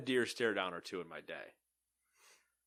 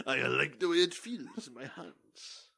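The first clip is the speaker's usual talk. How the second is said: raccoon voice; 3 semitones higher, slower than usual. Retold or imitated. Raccoon voiced holding food.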